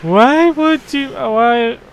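high, loud, drawn-out "why"; frustrated outburst. Real, not acted. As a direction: plead with the game in loud disbelief. Frustrated at the game.

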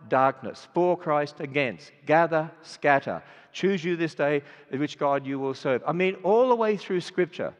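ordinary delivery

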